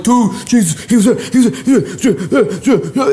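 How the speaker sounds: hammed-up high, breathless repeating; freaking out. Ten bros come up to Thomas, mock freak-out. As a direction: babble over each other like overexcited bros. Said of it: "they're freaking out".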